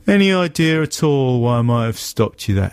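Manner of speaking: mock officious police question; lightly voiced patronising officer; smug cop voice. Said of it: "police officer voiced in story".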